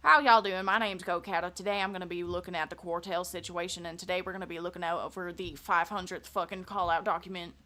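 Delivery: a light southern twang; southern accent. Shown as a demonstration. Streamer showing off a southern accent at work. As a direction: deliver it in a hint of Texan accent. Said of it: "if I use a southern accent".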